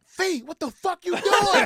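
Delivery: high, drawn-out alarmed shout; friends yelling. Retold or imitated. Friends in the car shouting at Fee, slow alarmed yell. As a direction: yell at him like he's lost his mind.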